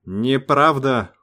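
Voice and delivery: slow, indignant protest. A narrator's reading. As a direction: push back, mildly outraged. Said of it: "вдруг запротестовал Сейга".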